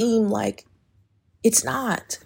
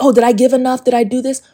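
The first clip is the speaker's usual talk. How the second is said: anxious people-pleaser; 6 semitones higher, 7 dB louder, faster than usual. Retold or imitated. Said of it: "you're like".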